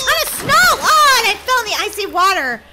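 high, loud alarmed yelling; playful panic. Real, not acted. Gamer slides on ice into water, playful panic. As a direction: yelp in panic as you slide. Sliding on icy track.

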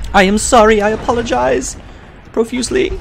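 high, loud over-the-top sorry; hammed-up apology. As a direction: grovel theatrically, way up high. Escalating mock apology.

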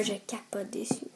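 whispered aside. Real, not acted. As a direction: breathe it like a secret confession. Excited aside about loved film. (chuchoté, en confidence)

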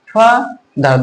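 "Father" pulled apart into ফা and দার; two separate beats for "father", teaching the syllables; split into syllables. Shown as a demonstration. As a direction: pause inside "father", right after ফা. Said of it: "দুইবারে উচ্চারণ করতে পারছি".

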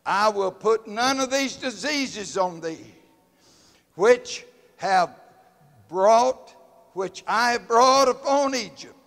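loud, high preaching of scripture; fiery Bible reading; preaching at full force. Acted. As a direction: proclaim it from the pulpit.

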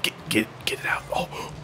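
tense breathy whisper; urgent whisper. Real, not acted. Gamer whispering tensely as the ball nears goal. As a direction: hush it, willing the ball out.

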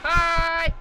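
shouted high hi; loud greeting. Real, not acted. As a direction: yell it out, high. Greets game monster loudly.

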